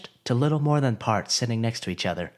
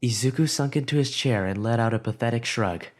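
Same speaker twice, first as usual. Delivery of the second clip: ordinary delivery, nothing marked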